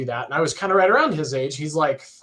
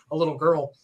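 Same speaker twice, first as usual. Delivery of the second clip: usual speaking voice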